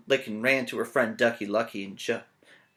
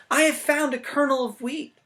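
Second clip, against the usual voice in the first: high, bright hen voice; little red hen; 11 semitones higher, 5 dB louder than usual. Acted. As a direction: squeak it out like a fussy little hen. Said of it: "said the little red hen".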